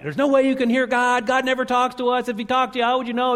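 put-on high, loud, insistent kid voice; high-pitched child adamantly arguing; fifth grader arguing. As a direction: mimic a stubborn kid who won't back down. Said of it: "he's just going at it".

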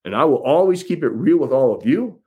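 ordinary talk, nothing marked

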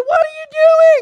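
very high, loud drawn-out yell; yelling parent. Shown as a demonstration. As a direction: shriek it like a parent scolding kids. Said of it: "like when I do with my kids".

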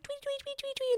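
tiny, very high-pitched chirps; bird tweeting. Retold or imitated. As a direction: imitate little birds chirping high and quick. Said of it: "and they're tweeting".

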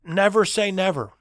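loud, high declaration; passionate professor. Retold or imitated. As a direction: proclaim the rule emphatically. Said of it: "and he said".

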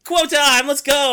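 cheering on the quote segment; hype. Real, not acted. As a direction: pump the crowd for quote time.

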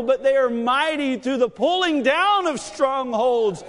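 preaching at full force. Acted. Pastor preaching victory of faith to his congregation, fervent preaching. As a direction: build the congregation up with conviction.

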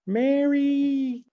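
high squealy drawn-out Southern call of "Mary"; goofy drunk Mary call. Retold or imitated. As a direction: squeal "Mary" like a tipsy goofball with a Southern twang. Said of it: "he would do that stupid sound".

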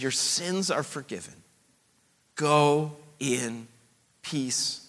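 low, very soft, slow; a gentle hush of release; Jesus softly sending the forgiven woman away in peace; tender blessing. Acted.

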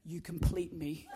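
a hammed-up romantic murmur; mock tender. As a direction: melt at her like a movie lover. Tender line to his rescuer wife.

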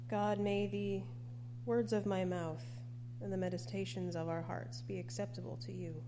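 ordinary delivery

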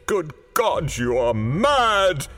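slow, high horrified cry; aghast. A narrator's reading. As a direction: exclaim in horror, drawing it out. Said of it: "he cried aghast".

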